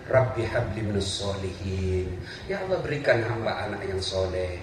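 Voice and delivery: put-on low, soft murmured prayer over the child. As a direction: pray it gently while spooning food. Praying while hugging child.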